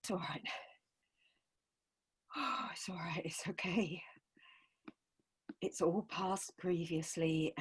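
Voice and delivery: soft, whispered reassurance; soothing whisper. Acted. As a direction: hush a frightened child. Mother soothing child in whisper.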